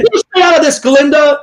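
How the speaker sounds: high-pitched, theatrical warning to a rival; villainess warning. Acted. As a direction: warn her off, pitch shooting up. Acted movie villainess warning rival.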